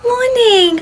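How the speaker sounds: loud bright fake-happy greeting; over-cheerful. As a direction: gush it at the parent. Greeting parent with forced cheer.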